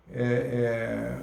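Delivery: usual speaking voice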